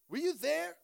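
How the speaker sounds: God demanding an answer of Job; booming challenge from God. Acted. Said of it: "And God says".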